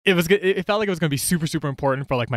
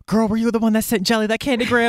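gossipy classmate; 7 semitones higher than usual. Retold or imitated.

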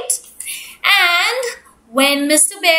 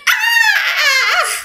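high, startled yelp; startled bear scream; 14 semitones higher than usual. Acted. Bear startled by duck's face above him, high startled yelp. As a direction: jump out of your skin. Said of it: "Mister Bear was like".

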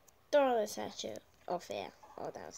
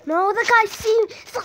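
loud panicked cry; panicked shout; 11 semitones higher, 15 dB louder than usual. Real, not acted. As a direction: yell in alarm at being spotted. Spotted by enemy in game.

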